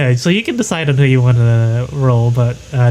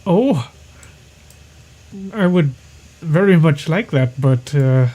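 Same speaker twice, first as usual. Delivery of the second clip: higher, hesitant frog-creature voice; lightly voiced Bullywug; 5 semitones higher than usual. Captive Bullywug to would-be rescuer, lightly voiced hesitation. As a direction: lightly voice a creature unsure of the offer. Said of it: "the Bullywug says".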